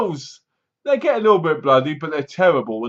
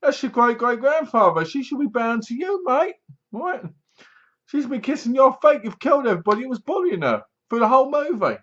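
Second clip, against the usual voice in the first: exasperated outburst; 9 semitones higher than usual. Retold or imitated. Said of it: "And you're like".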